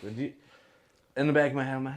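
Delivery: ordinary talk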